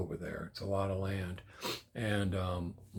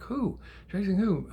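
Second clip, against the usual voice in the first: alarmed questioning; 10 semitones higher than usual. Retold or imitated. Grandpa hears of the chase, alarmed questioning. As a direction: pitch leaping high in disbelief.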